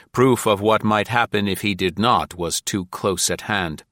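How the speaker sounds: usual speaking voice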